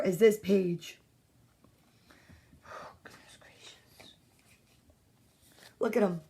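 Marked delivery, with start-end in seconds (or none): 2.7-4.1 breathy whispered wonder. Real, not acted. Teacher whispering over a cute picture. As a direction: gasp it in a whisper.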